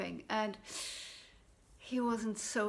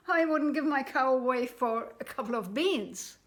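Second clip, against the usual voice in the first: indignant; 3 semitones higher, 7 dB louder than usual. Acted. Jack refuses the swap.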